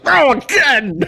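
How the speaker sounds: hammed-up wail of disbelief; mock dismay. Apocalypse voiced, laughing.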